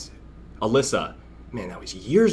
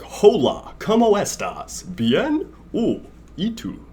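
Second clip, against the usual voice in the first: higher, mock-careful gringo Spanish; clumsy Spanish; 8 semitones higher, slower than usual. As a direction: sound out the Spanish like a nervous beginner. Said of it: "excuse my Espanol".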